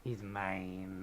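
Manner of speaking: low, quiet villain claim; a lightly menacing Sith lord. Pretend.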